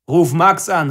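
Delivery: loud call after someone leaving. Acted. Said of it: "rief er mir noch nach".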